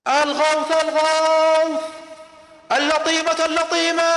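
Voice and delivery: high, loud cry for help; desperate scream. Acted. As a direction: scream it out like a man raising the alarm. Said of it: "بدأ يصرخ". (صراخ استغاثة عالٍ)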